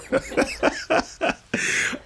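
a breathy burst of laughter; real laugh. Real, not acted. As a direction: laugh at your own joke. Laughing at his own joke.

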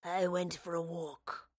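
Robert brushing off the question; grunted. A narrator's reading. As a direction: sullen few words. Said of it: "Robert grunted".

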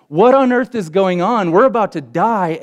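high, frantic outcry; alarmed and disbelieving; frantic disciples. Retold or imitated. Disciples waking their teacher, high and frantic.

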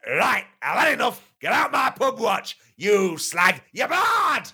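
high shrieking ejection; furious chairman. Acted. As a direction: throw him out in a high fury. Chairman throws member out.

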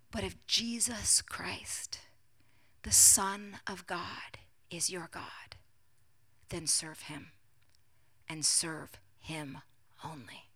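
slow, low hush, weighing each phrase; soft and grave, with long pauses; hushed, solemn preaching. Acted. Preacher quotes Elijah's challenge to the congregation, hushed and solemn.

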